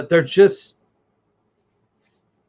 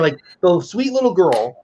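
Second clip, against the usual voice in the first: ordinary talk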